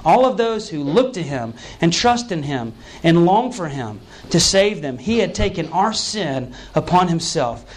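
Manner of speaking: normal talking voice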